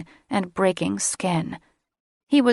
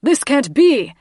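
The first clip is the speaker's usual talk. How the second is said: higher, loud furious bellow; 8 semitones higher, 7 dB louder than usual. Acted. Dragon father bellowing at news of his son's death, loud and high. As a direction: roar the denial. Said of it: "Dagon bellowed".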